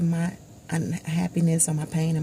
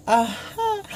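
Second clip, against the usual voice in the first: high, loud, drawn-out knowing "Uh-huh"; a teasing, all-knowing uh-huh; 10 semitones higher, 6 dB louder than usual. Retold or imitated. Friend's knowing reply.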